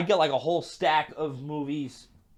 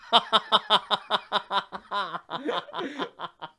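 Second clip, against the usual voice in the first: real helpless laughter, drawn out; long laughing fit; 6 semitones higher than usual. Real, not acted. Comedian amused by his dream story, real laughing fit. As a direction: crack up at your own story.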